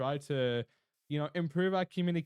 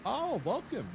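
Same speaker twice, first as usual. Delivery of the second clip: excited welcome; 12 semitones higher, slower than usual. Real, not acted. Greeting first Russian viewer.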